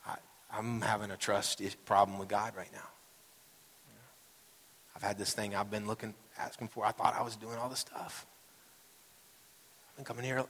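soft, subdued confession; quiet disappointment. Acted. Pastor voicing churchgoers who doubt God, quiet disappointment. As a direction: voice a weary doubt gently, understated.

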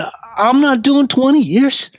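high, startled blurt of disbelief; stunned disbelief. Retold or imitated. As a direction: squeak it out, can't believe it. Stunned reply to being freed.

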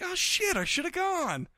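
squeaky dismayed realization; high-pitched regretful gasp; high mock surprise. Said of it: "I'm like".

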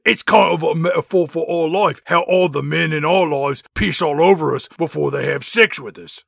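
put-on cockney girl gossip; mock cockney. Spice Girls banter.

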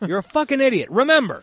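high, loud mock yell. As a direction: belt the insult out. Repeating a show line louder.

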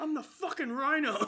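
high, hammed-up boast; mock Rhino line. Riffing on the Rhino quote, high hammed boast. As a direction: toss it off in a silly Rhino voice. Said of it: "quoting the Rhino line again".

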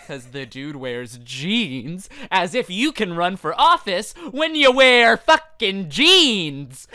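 drawn-out outburst building to a shout; exasperated rant. Pretend. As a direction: rant in mock outrage, hammering "jeans". Mock outraged rant about jeans.

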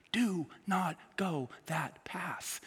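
whispered, slow warning to the congregation; a slow, whispered caution; hushed warning. Real, not acted. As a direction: drop to a whisper and slow right down.

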